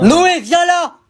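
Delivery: voix de mère, criée très aigu; mère qui appelle. Acted. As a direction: appelle son fils depuis l'autre pièce. (mère qui crie le prénom de son fils)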